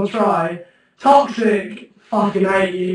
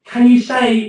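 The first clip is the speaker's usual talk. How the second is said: ordinary talk, nothing marked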